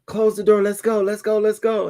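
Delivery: high, urgent mock panic; panicked getaway. People in the car urging a getaway, high mock panic. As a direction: hurry the driver like fleeing thieves. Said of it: "And them in the car".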